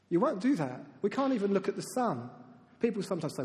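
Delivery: normal talking voice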